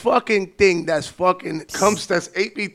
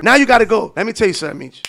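normal talking voice

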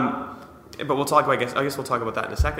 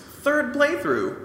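stretched-out, much higher disbelief; incredulous; 14 semitones higher, much slower than usual. Real, not acted. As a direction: say it like you can't believe it. Incredulous echo of cohost's claim.

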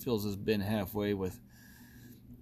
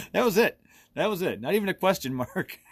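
high, loud, breaking out of laughter; amused outburst; 9 semitones higher, 6 dB louder than usual. Real, not acted. Host amused by a listener's terse message, laughing outburst. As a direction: exclaim it while cracking up.

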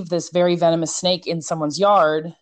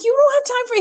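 much higher incredulous protest; faster than usual. Retold or imitated. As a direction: squeak out your disbelief. Protesting to animal control man.

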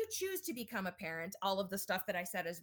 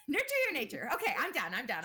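high, loud, breathless from laughing; after laughing hard; 5 semitones higher, 6 dB louder, faster than usual. Real, not acted. After both laughed at pun.

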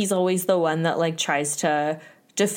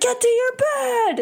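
very high-pitched mock yell; Pauly yelling. Pauly after being smacked in the face, mock angry yell. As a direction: bellow it in imitation of an angry housemate. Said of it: "she smacks Pauly in the face".

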